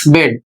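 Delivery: "बेड" with E said as a short ए; short e pronunciation. Shown as a demonstration. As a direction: model "बेड" as one word with a short eh vowel. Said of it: "इसका उच्चारण हो जाएगा".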